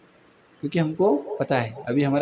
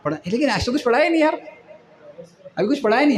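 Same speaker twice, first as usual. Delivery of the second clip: higher-pitched, quick student whine, acted out; mock student complaint; 7 semitones higher, faster than usual. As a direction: imitate a student protesting lightly. Student voiced in procrastination story. (छात्र की नकली शिकायत, ऊँची आवाज़ में)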